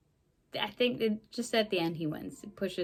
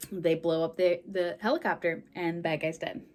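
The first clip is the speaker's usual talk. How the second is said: usual speaking voice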